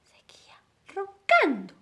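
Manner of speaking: whispered, breathy ending. A narrator's reading. Narrator whispering that the dog still snores. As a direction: breathe it out softly.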